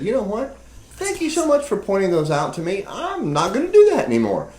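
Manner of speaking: high, sing-song mock thanks; mock grateful reply. Husband mocking a thankful partner, high sing-song voice.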